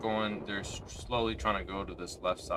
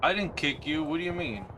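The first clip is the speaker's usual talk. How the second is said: insistent; 6 semitones higher, 4 dB louder than usual. Real, not acted. Insists to teammate Vincent.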